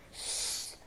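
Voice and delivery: a breathy whispered sst; whispered hush. A narrator's reading. Koen hushing Lot behind the hedge, whispered. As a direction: hush her without any voice. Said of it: "zegt Koen zacht".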